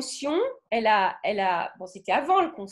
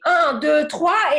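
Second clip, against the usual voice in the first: loud, higher count into a fight; angry count; 4 semitones higher, 9 dB louder, much slower than usual. Shown as a demonstration. Teacher counting into a mock brawl, loud and angry. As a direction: count down like you're about to swing. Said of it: "je vais aller me bagarrer".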